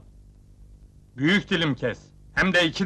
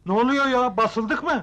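high-pitched, startled questioning; startled alarm; 6 semitones higher than usual. Acted. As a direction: react as if caught in a raid. Woken abruptly, thinks it's a raid. (irkilerek, telaşla)

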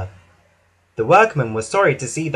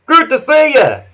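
much higher, louder cheerful call; happy whoosh; 11 semitones higher, 8 dB louder than usual. A narrator's reading. Hank greeting the children, happy and high. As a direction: beam at the waving children. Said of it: "Hank whooshed happily".

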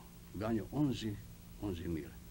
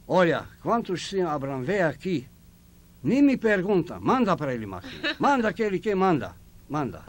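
emphatic order; 6 semitones higher, 9 dB louder than usual. Retold or imitated. Boss ordering staff, emphatic.

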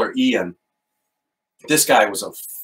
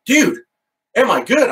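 much higher, louder "am I good?"; incredulous outburst; 10 semitones higher, 4 dB louder than usual. Retold or imitated. Priest answering Father Ian, shaken and incredulous. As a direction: blurt it like he can't believe the question. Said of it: "And I'm like".

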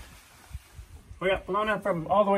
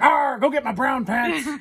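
higher, louder, slow hammed-up pirate captain; pirate captain punchline; 9 semitones higher, 6 dB louder, slower than usual. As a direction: deliver the punchline like a rattled pirate captain, slowly. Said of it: "And he goes".

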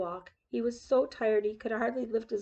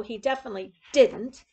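louder, stern refusal; dreadful tone; 7 dB louder than usual. A narrator's reading. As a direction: snap the refusal. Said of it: "in a dreadful tone of voice".

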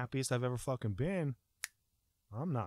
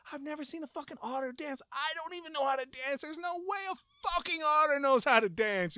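high-pitched panic; 13 semitones higher than usual. Retold or imitated. Man dealt an otter card who must dance, high panicked protest. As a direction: protest in a high voice, rising to an outburst.

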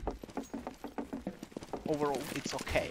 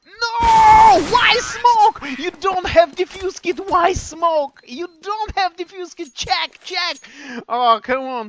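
frustrated shouting; 12 semitones higher, 15 dB louder, slower than usual. Real, not acted. Caster frustrated at a mistake.

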